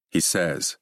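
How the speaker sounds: usual speaking voice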